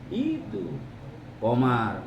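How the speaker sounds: normal talking voice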